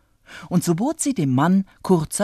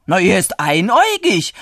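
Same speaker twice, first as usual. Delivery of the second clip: loud, certain answer; emphatic, no room for doubt; decided; 4 semitones higher, 6 dB louder than usual. A narrator's reading. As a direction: state it firmly, louder and higher. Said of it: "antwortete der Bauer bestimmt".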